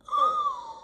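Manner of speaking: sharp high gasp of shock. Retold or imitated. Discovers the name on form. (halètement aigu de surprise)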